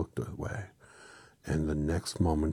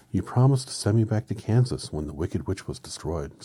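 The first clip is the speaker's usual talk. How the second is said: put-on girl's voice; 4 semitones higher, 7 dB louder than usual. Dorothy pleads.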